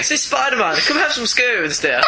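mock genteel British. Villain inviting Spider-Man for scones, mock genteel British. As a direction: invite him daintily, hammed up. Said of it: "kind of voice, can't you?".

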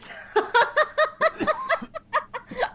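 long, high-pitched burst of laughter; hard laughter. Real, not acted. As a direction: crack up laughing at your own retelling. Cracks up at own retelling.

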